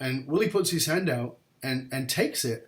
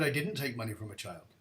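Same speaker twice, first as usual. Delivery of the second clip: calm denial; 3 semitones lower, 7 dB quieter than usual. Retold or imitated. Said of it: "And he said".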